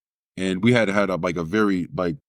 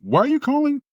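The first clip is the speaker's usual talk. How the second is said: front desk voice; 3 dB louder than usual. Retold or imitated.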